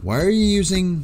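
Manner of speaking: high, loud disbelief; exasperated outburst. Real, not acted. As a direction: blurt it out in disbelief. Exasperated at chatter's browser choice.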